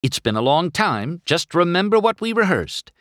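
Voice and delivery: high, rallying pep talk; excited Sally. A narrator's reading. Sally readying the town for customers, excited and high.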